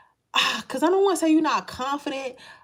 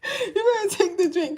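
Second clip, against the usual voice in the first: higher, louder bossy auntie, laughing; lightly played sassy elder; sassy spirit auntie; 6 semitones higher, 3 dB louder than usual. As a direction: order her like a blunt auntie. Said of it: "No, they like".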